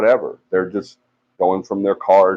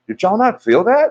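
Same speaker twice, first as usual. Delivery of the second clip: high, urgent disbelief; alarmed question; 13 semitones higher than usual. Retold or imitated. Asking two guys outside the store, alarmed and high. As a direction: demand to know if they felt it. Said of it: "and I said".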